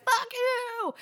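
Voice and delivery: very high strained mock yell; squeaky yell. Freddy yelling back at the other inmates, squeaky mock yell. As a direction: squeal it out in a cartoon pitch. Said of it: "And he's like".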